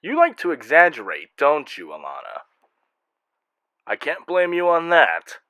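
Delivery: usual speaking voice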